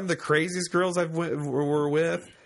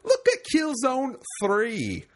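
slow, higher, hammed-up British accent; bad jolly British accent; 6 semitones higher, slower than usual. Host reading listener's letter, bad jolly British accent. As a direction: put on a badly faked jolly British voice, laughter creeping in. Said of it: "I'm gonna try a jolly British accent".

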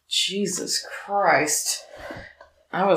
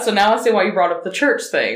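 ordinary talk, nothing marked

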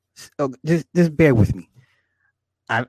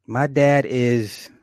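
ordinary talk

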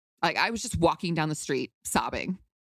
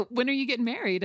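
coy teasing; 8 semitones higher, faster than usual. Retold or imitated. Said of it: "coy and cute".

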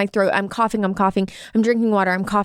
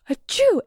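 sharp gasp, then a high mock achoo; acted-out sneeze; 11 semitones higher than usual. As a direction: mimic a big sneeze for the story.

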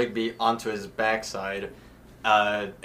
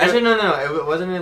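much higher, loud, urgent; excited correction; 8 semitones higher, 9 dB louder, much faster than usual. Real, not acted. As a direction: burst in to correct.

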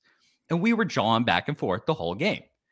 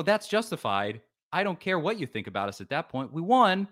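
ordinary delivery

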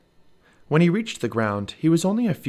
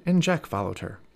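ordinary delivery

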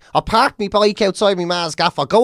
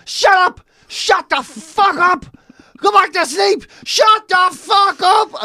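yelling back; 12 semitones higher, slower than usual. Retold or imitated. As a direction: roar at the dog to shut up. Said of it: "And I was like".

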